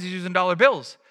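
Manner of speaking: normal talking voice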